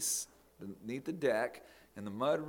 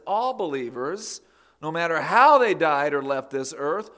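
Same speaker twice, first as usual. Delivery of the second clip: louder and higher, driving each phrase home; preaching at full force; 7 semitones higher, 7 dB louder than usual. Acted. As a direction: build the sermon up like a rally cry.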